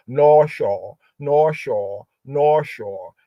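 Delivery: "North Shore" with rounded aw vowels in both syllables; "North Shore", both vowels drawn out as "aw"; mistaken phonetic reading. Shown as a demonstration. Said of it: "The phonetics they be having".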